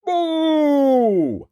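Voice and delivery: high, drawn-out booming calls; loud giant's cry. A narrator's reading. Narrator sounding the giant's approach, booming loudly. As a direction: let each word ring out long. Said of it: "a loud".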